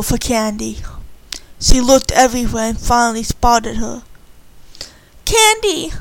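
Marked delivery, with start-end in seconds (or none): 5.2-5.9 high-pitched call of a name. A narrator's reading. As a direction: call across the yard to find her. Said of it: "Annie cried".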